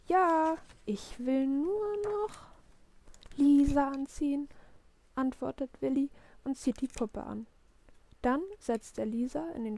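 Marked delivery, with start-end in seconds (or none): 0.1-4.5 put-on high, slow, drawn-out kid's reply; stalling child. A narrator's reading. Willi still dressing his doll Lisa, stalling child.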